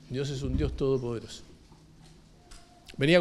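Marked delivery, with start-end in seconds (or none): 0.1-1.4 quiet echo. Acted. Preacher repeating it softly to congregation, hushed echo. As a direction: drop lower and much softer.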